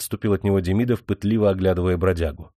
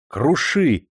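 encouraging call; 6 semitones higher, 4 dB louder than usual. A narrator's reading. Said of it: "ободряюще позвала она".

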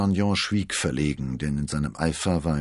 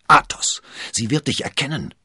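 higher, louder anxious warning; urgent warning; 10 semitones higher, 9 dB louder than usual. A narrator's reading. D'Artagnan warning Athos that she will recognise him. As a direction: hiss his name in alarm. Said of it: "warnte D'Artagnan".

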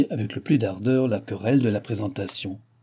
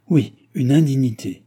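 louder, higher, falling exclamation; indignant echo; 4 semitones higher, 7 dB louder than usual. A narrator's reading. Madame de Guéménée backing the duchess, indignant exclamation. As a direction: back her up with some outrage. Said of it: "répéta madame de Guéménée".

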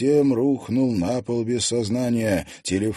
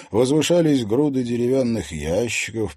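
usual speaking voice

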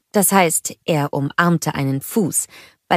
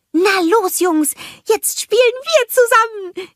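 cheery greeting; 4 dB louder than usual. A narrator's reading. Olaf greeting the snowgies, high and happy. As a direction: call out warmly to the little ones. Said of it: "rief Olaf".

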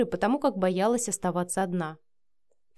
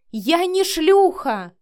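loud, high, drawn-out denial; indignant outburst; 9 semitones higher, 8 dB louder, much slower than usual. A narrator's reading. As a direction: hurl back the insult in a raised voice. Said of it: "выпалила Вика". (возмущённо, громко выпалить)

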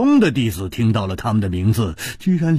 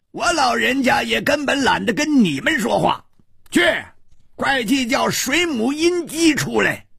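put-on much higher, gruff old man roaring orders; angry old beggar shout; 14 semitones higher than usual. A narrator's reading. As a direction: bark it out as a furious old man. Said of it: "怒喝道". (老人家怒喝)

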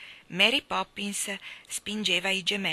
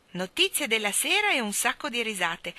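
plain reading voice

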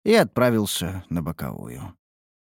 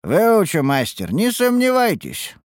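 lightly raised assured voice; confident voice; 11 semitones higher, 4 dB louder, slower than usual. A narrator's reading. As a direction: promise the master, drawn out. Actor voiced.

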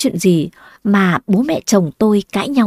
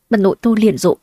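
plain reading voice

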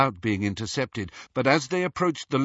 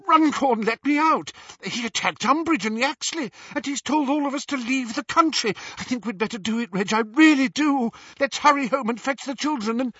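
high anxious woman's voice; pleading, worried and urgent; frightened wife; 13 semitones higher, 3 dB louder than usual. A narrator's reading. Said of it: "Mrs Cattermole pleading".

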